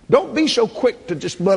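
ordinary delivery

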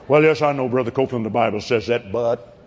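low, subdued mock doubter; hedging objector. Preacher imitating someone objecting to him, hedging unbelief. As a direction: voice a reluctant yes-but, pitched low.

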